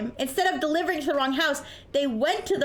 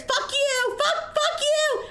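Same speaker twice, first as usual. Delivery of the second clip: retold yelling; 13 semitones higher, much slower than usual. Driver yelling at the neighbor over the phone, high mock yell. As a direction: imitate someone yelling curses down the phone. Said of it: "he wasn't yelling that at me".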